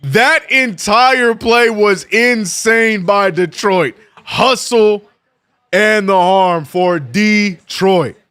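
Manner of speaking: loud, high sportscaster call with stretched names; big broadcast hype, punching each name; excited play-by-play. Real, not acted. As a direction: call the basket live, building to a drawn-out shout.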